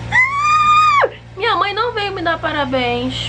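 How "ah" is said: piercing squeal of joy; high excited scream. Real, not acted. Birthday girl shrieks with excitement, piercing squeal. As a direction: shriek with delight.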